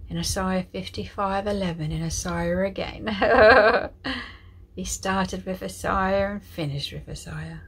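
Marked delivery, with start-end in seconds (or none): 3.1-4.7 loud spontaneous burst of laughter; delighted laugh. Real, not acted. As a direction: laugh out loud, openly amused. Amused at Isaiah coming up again.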